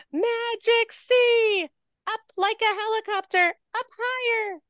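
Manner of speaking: high, slow playful squeak; very high silly guiding chant; squeaky silly voice. Shown as a demonstration. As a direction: sing it squeaky for the kids. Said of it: "Should I use a silly voice?".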